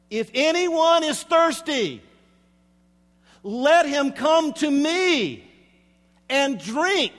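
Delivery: high, loud, slow proclamation to the crowd. Shown as a demonstration. Preacher quoting Jesus to the whole crowd, loud and slow. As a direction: call it out so everybody hears. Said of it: "may I say in a loud voice".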